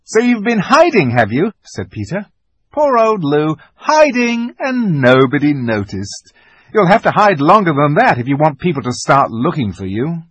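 loud, mocking big-brother voice; a brother ribbing his sister; teasing older brother. A narrator's reading. Peter teases Lucy.

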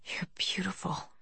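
soft whisper, breathless with awe; awed whisper. A narrator's reading. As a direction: hush it as if in wonder. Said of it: "He lowered his voice as if in awe".

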